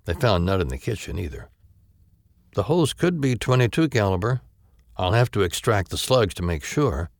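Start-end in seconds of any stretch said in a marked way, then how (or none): 2.5-7.1 higher-voiced matter-of-fact examiner; a brisk doctor at a crime scene; female doctor voice.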